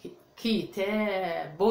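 kitab, slow and syllable by syllable, ki-taab; slow teacher demo of كتاب; slow sounded-out word. Shown as a demonstration. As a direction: stretch كتاب out so children hear each sound. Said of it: "لاحظوا أطفال".

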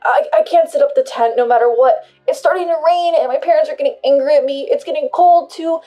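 loud, high, rising panic; trembling, panicked. Acted. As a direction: whine it out in distress. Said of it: "confusion and trembling voice".

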